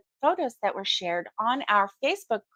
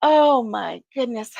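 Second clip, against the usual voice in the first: ordinary delivery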